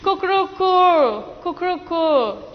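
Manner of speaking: high little bird cry; playful chick sound; chick call. Shown as a demonstration. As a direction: cuckoo like a chick peeping. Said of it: "which was left now".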